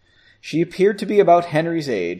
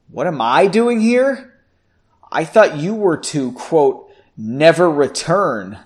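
louder, mocking echo of a question; sarcastic; 3 semitones higher, 6 dB louder than usual. A narrator's reading. Margot confronting Henry on his return, sarcastic. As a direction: throw it back with sarcasm. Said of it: "responded sarcastically".

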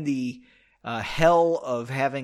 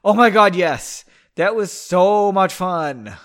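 louder, gushing oh my God; 2 semitones higher, 8 dB louder, slower than usual. Acted. Pauline telling Henry about the party, loud gushing.

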